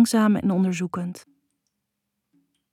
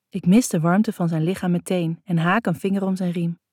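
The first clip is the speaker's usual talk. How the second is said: usual speaking voice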